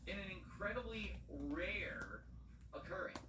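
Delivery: slow dramatic whisper. Real, not acted. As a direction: hush it, slowly. Dramatic stream buildup.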